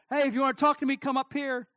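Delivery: high, loud call to another floor; calling downstairs. Retold or imitated. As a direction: imitate a wife calling from upstairs. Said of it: "she's up in the bathroom".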